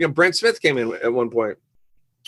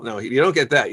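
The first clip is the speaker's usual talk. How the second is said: ordinary delivery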